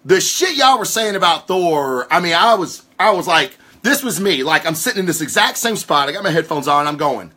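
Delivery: ordinary delivery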